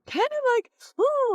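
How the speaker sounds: high, fast, jittery head voice; nervous high voice. Shown as a demonstration. Coach showing how nerves push the voice up high. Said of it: "what happens when we get nervous".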